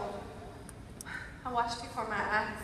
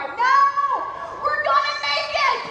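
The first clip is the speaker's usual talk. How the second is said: higher, louder defiant shout; 14 semitones higher, 10 dB louder than usual. Retold or imitated. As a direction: yell it with full authority. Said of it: "shout at the top of my voice".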